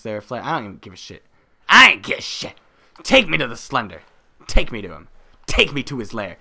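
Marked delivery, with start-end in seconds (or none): 1.7-2.6 high, very loud mock bravado; defiant shout. Player done caring about the flare, defiant shout. Shouted repeat of previous line. 3.0-6.4 loud, forceful demand; mock commanding. Player demanding to meet Slender, mock commanding shout.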